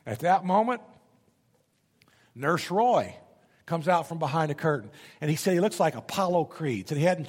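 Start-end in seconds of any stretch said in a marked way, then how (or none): none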